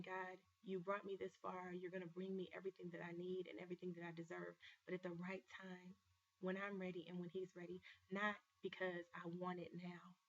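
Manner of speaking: prayer. Acted. Prays to God.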